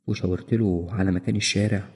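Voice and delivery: usual speaking voice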